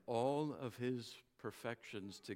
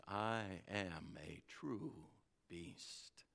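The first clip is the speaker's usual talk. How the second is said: Aslan; 3 dB louder, slower than usual. Acted. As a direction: draw the frightened horse near gently. Aslan speaks gently to Bree.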